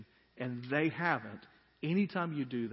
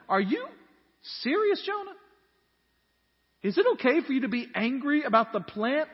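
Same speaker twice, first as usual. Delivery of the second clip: incredulous; 13 semitones higher, 12 dB louder than usual. Acted. God questions sulking Jonah.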